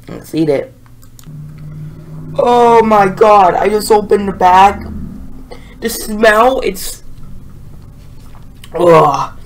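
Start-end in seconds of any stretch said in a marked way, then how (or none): 2.4-7.0 smelling something awful. Real, not acted. Opening the pepper bag, smell hits. 8.7-9.4 disgusted groan. Real, not acted. Reacting to the pepper smell.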